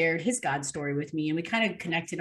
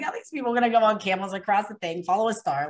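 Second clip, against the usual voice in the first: raised, louder skeptical question; incredulous; 5 semitones higher, 5 dB louder, faster than usual. Retold or imitated. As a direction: scoff in mild disbelief. Retold doubting of Bible story.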